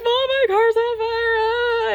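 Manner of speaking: high, loud imitated wail; hammed-up tearful phone cry; mock crying. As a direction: wail it to mom like a hysterical kid. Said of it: "I'm, like, crying".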